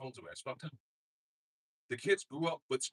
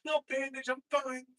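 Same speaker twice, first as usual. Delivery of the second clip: much higher breezy reassurance; mock Trump; 12 semitones higher than usual. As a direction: brush off the wound lightly. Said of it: "he was like".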